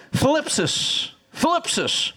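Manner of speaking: thlipsis said like flip with a lisp, then sis; Greek word thlipsis. Shown as a demonstration. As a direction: thlipsis with a lisped th and stress on the first syllable. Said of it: "I'm gonna teach it to you".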